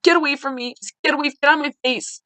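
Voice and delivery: furious mother shouting at daughter; screaming. Retold or imitated. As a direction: push her away with your voice. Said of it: "and I just screamed".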